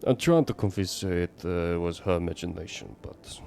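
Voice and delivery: put-on neighbor's troubled confiding in a Russian accent; bad Russian accent. Neighbor worries daughter saw faces, bad Russian accent. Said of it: "bad Russian accent right now for your neighbor".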